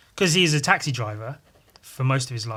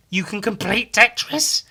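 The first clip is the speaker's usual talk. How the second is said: higher, louder retold disbelief; surprised disbelief; 9 semitones higher, 5 dB louder than usual. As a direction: gasp it like news you can't believe. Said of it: "My first thought being".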